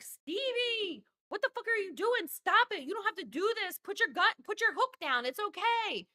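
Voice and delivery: high, hammed-up plea to drop the hook; mock pleading Ray. Ray begging his girl Stevie, high mock plea. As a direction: beg her like a panicked dad.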